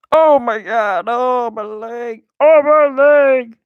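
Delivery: high slow mock moan; hammed-up pained cry. Gamer voicing hurt character, high slow mock moan. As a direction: moan over the leg theatrically.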